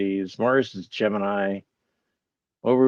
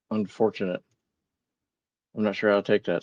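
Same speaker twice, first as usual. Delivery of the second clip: ordinary delivery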